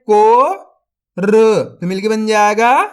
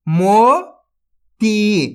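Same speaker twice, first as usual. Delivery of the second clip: मोती drawn out very slowly, syllable by syllable; sounded out slowly; much slower than usual. Shown as a demonstration. Teacher sounds out a word for young readers, very slowly. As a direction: pull मोती apart slowly. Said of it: "तो मिलके बन जाएगा".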